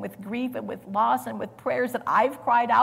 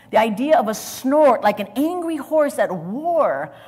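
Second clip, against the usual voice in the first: louder, driving the image home; forceful preaching; 4 semitones higher, 8 dB louder than usual. Acted. Preacher painting Jesus' anger, forceful and loud. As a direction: hammer each word like a war horse snorting. Preacher describing angry snort.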